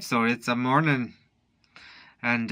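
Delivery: ordinary talk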